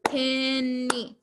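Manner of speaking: Penny drawn out as pen-ny; two long separate beats on Penny; syllable by syllable. Shown as a demonstration. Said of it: "How many syllables are in penny?".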